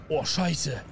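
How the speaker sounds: normal talking voice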